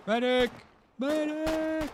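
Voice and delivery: loud, high call for a medic; man down shout. Real, not acted. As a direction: yell for help across the field. Wounded player calls for help.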